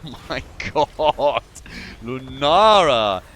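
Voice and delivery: drawn-out, astonished exclamation; amazed reaction. Real, not acted. Caster reacts to big play.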